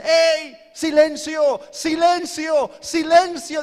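high, loud repeated command; Satanás shouting. Acted. Devil demanding silence from his demons, urgent shouting. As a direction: shout down a rowdy crowd. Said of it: "Y Satanás dice".

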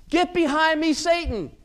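loud, high commanding rebuke; stern rebuke. Acted. Jesus rebuking Peter before the disciples, loud stern command. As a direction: cast him off with force. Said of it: "he rebukes Peter".

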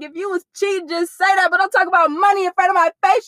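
telling him off, loud and high; retold angry callout. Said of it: "I was like".

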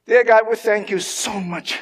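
normal talking voice

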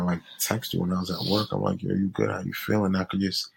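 ordinary talk

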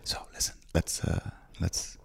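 hushed conspiratorial whisper. Pretend. Whispering to his co-host like a secret plot. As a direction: lean in and whisper the plan.

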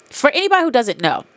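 ordinary talk

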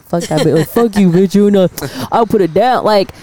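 ordinary talk, nothing marked

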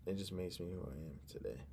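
ordinary talk

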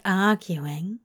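mild amused correction ending in a small chuckle; fond chuckle. A narrator's reading. Zira correcting a confused Crowley, fondly amused. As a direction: tease him fondly, chuckle after. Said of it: "Zira chuckled fondly".

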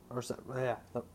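stumbling over the words; stutter. Real, not acted. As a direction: trip over the words and stop. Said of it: "sorry for also that stutter right there".

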